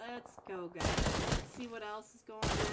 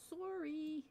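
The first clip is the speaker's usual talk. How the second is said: higher, soft Canadian "sore-ee"; Canadian accent; 5 semitones higher, 5 dB quieter than usual. Shown as a demonstration. Caster checking it sounds Canadian enough, mock Canadian accent. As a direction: exaggerate the Canadian rounded vowel. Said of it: "That sound Canadian enough?".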